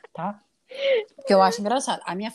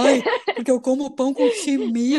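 mock smug descendant of Germans, pitched up; mocking southerner; 4 semitones higher, 3 dB louder than usual. Mocking the Paraná German descendants, smug put-on voice.

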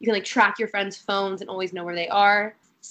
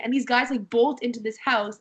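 ordinary talk, nothing marked

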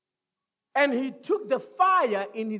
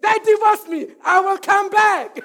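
raised declaration, louder and higher; declare it loud; 6 semitones higher, 8 dB louder than usual. Shown as a demonstration. Pastor leads the church to declare it, loud. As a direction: proclaim it with faith. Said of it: "Now say it".

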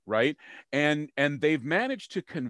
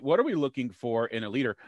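ordinary talk, nothing marked

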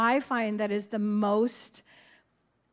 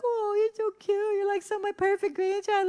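much higher doting coo; 9 semitones higher than usual. Retold or imitated. Grandma cooing at her perfect grandchild, much higher. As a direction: coo over him like a proud grandma. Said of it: "I'm like".

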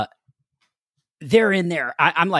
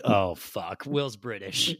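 dismayed aside; 4 semitones lower, 9 dB quieter, slower than usual. Retold or imitated. Dismayed on hearing reader's accent.

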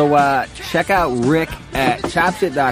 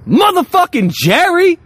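big excited shout, pitch jumps way up; hype shout; 5 dB louder than usual. Real, not acted. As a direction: announce him like a ring announcer. Hyping the giveaway sponsor.

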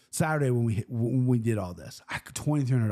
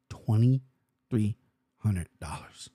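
low, slow, stunned repeat of the price; stunned disbelief; 5 semitones lower, slower than usual. Real, not acted. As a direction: let the number sink in, slowly.

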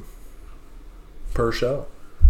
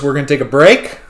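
ordinary talk

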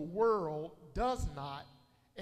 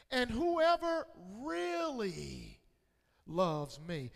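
"really" drawn out slowly with a swell in pitch; stress on really; slower than usual. Acted. Preacher reading Jesus' words to church, "really" stretched out for emphasis. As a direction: lean long and hard on "really". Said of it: "why is Jesus emphasizing the word really".

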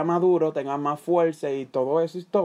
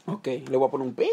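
ordinary talk, nothing marked

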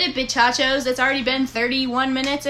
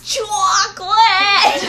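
drawn-out, high, loud cartoon worm shout; old earthworm voice; 8 semitones higher, 9 dB louder, much slower than usual. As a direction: stretch it out slowly and wail. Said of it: "You sounded like, um, that old earthworm".

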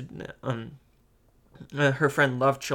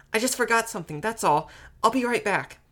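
put-on high-pitched girl covering up; slightly panicky; 10 semitones higher than usual. A narrator's reading. Little sister excuses herself to Mother, slightly panicky. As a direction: make a nervous excuse, pitched up. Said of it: "said in a slightly panicky voice".

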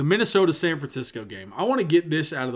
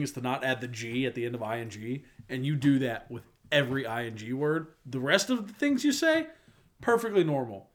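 ordinary talk, nothing marked

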